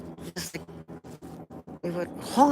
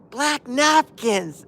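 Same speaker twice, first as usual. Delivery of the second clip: slow, higher, deliberate title; neighbor's answer; 9 semitones higher, 4 dB louder, much slower than usual. Retold or imitated. The neighbor calling down the song's title. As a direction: spell it out slowly for him. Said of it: "he says".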